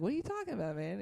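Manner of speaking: high, baffled question; confused. Retold or imitated. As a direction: ask like you can't believe it. Confused reply to drunk.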